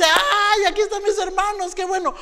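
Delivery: very high, loud happy greeting; light up seeing your brothers; delighted cry. Acted. Joseph spotting his brothers, joyful.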